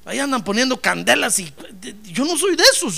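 mildly frightened high pleading; begging plea. Acted. Daniel begging for his life.

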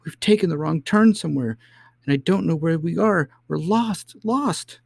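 very high, panicked nurse; high-pitched wail of despair; terrified cry. Acted. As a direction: cry out in rising terror. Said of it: "gave a great cry".

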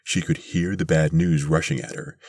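plain reading voice

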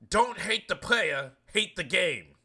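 high, loud, slow preacher-like boom; hammed-up celebrity motivational voice, every word weighty; Steve Harvey impression. Mocking Steve Harvey's motivational Instagram video, in a loud, high, slow imitation. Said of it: "That's my best Cleveland accent I can do".

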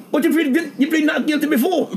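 put-on high-pitched incredulous girl; imitated shocked court girl; mimicked astonishment. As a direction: question it high, in disbelief. Girl's startled reply acted out.

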